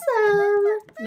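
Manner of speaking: high, loud squeaked exclamation; squeaky voice. Real, not acted. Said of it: "my voice just, like, squeaked like crazy".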